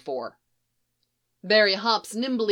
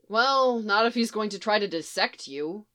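ordinary delivery

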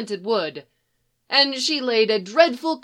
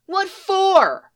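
put-on high, louder kid's protest; indignant boy; 11 semitones higher, 5 dB louder than usual. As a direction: snap back like a mouthy kid.

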